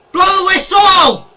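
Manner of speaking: high, loud shout for the whistle; pleading with the referee. Real, not acted. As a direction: scream at the ref to end it.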